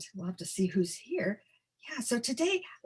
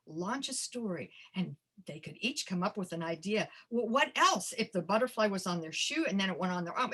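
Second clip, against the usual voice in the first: usual speaking voice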